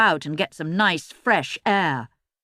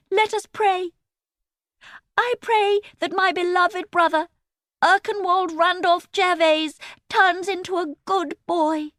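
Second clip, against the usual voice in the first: pious prayer; 11 semitones higher than usual. A narrator's reading. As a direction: pray over him smugly. Emily praying piously.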